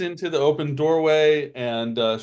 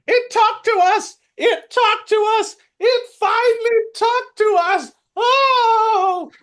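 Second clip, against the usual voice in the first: very high, loud squealing kobold joy; shaking each other with giddy kobold glee; excited kobolds; 7 dB louder than usual. Acted. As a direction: squeal it like overjoyed little kobolds. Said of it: "shaking each other by the shoulders, going".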